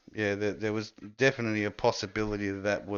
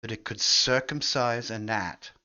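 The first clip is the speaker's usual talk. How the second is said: slow deadpan punchline; slower than usual. Acted. Punchline of a joke.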